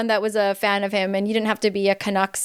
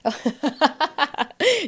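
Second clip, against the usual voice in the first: rapid, higher-pitched run of laughter; real laugh; 6 semitones higher than usual. Real, not acted. Host laughing after both gave way to each other, real laugh. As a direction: burst into a quick string of ha-has.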